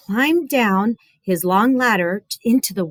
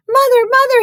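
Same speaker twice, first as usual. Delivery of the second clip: put-on high, loud frantic boy; crying out; 7 dB louder, faster than usual. Chen running to his mother, high panicked cry. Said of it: "he cried".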